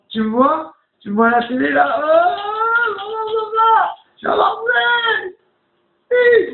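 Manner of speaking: mock gushing shout to parents on live TV; mocking TV shout-outs. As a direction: ham up a gushing caller greeting family on air. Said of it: "C'était du".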